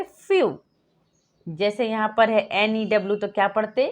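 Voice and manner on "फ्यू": "फ्यू" lifted high with a clear yoo; bright high answer "फ्यू"; ew sound demo. Shown as a demonstration.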